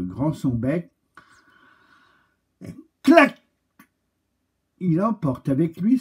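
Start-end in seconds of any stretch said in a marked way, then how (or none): 2.6-3.4 sharp high clac, much louder; beak snap. A narrator's reading. Snapping beak sound word.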